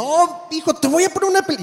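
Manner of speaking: high, eager coaxing to his son; playful dad coaxing his son. Retold or imitated. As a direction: pitch it up like a dad talking his kid into a movie. Telling son to watch film.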